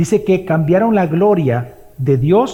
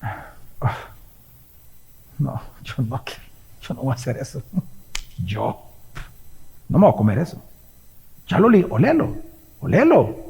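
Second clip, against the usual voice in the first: a soft, sniffy lightly voiced grumbler; hammed-up refusal of the offering; mock disdainful people; 4 semitones lower, 6 dB quieter than usual. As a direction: mutter like someone sniffing at bad food. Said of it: "y hacen esto con Dios".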